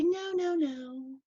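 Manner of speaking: high, airy mocking of the friend's protest; mock denial. Teasing friend about refusing the truth, high mock denial. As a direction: parody her stubborn no, no, no.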